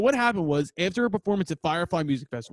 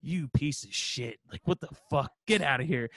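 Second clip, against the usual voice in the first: silent-stare contempt, voiced; 3 semitones lower, 4 dB quieter than usual. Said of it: "the kid's just staring at us like".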